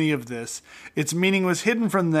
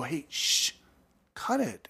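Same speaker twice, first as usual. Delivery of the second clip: soft, sharp shushing; hushing; 9 dB quieter, slower than usual. Acted. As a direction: shush him under your breath. Crowd hushes the beggar.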